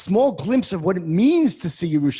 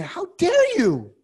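slow, much higher, louder indignant demand; outraged, stretched out, pitched high; how dare you; 12 semitones higher, 4 dB louder, slower than usual. Acted. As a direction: challenge God with the question. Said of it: "That's what he's saying here".

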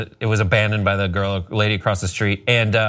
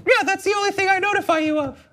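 very high cartoon dog voice; talking dog; faster than usual. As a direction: speak as an eager dog, pitched way up. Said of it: "He's like".

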